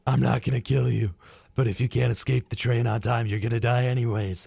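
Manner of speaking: deadpan fake hero reasoning; lightly voiced Batman excuse; mock Batman. Voicing Batman's mock justification.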